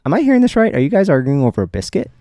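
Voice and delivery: can't believe this is over a biscuit; playfully astonished retold question; incredulous question. Asking in disbelief about biscuit.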